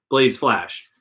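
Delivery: ordinary delivery, nothing marked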